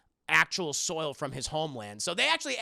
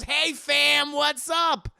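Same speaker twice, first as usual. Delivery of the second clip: loud, high, hammed-up party hello; loud mock greeting; 9 semitones higher, 10 dB louder, much slower than usual. Dracula walks in on the group, hammed-up loud hello. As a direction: burst in hollering a hammed-up greeting. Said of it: "Dracula just walks in and goes".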